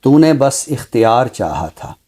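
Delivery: measured mushaira-style poem reading; ghazal recitation. Acted. (शायराना अंदाज़ में ग़ज़ल पढ़ना)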